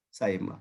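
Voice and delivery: سائمہ said softly with a light, easy middle sound; easy in-between. Shown as a demonstration. Teacher models the easy سائمہ for the students. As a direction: ease سائمہ out gently, no hard break. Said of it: "پڑھیں گے تھوڑا سا easily".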